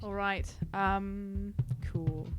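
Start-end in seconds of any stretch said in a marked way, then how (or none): none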